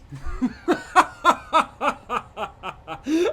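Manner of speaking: high, loud villain cackle; evil laugh. Pretend. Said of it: "do an evil laugh".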